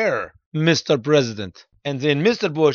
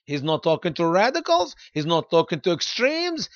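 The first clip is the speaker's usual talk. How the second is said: ordinary talk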